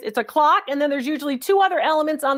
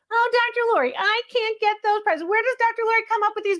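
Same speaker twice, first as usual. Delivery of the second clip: higher, half-hearted whiny viewer voice; mock whiny viewer; 7 semitones higher than usual. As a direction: play a doubting viewer, lightly.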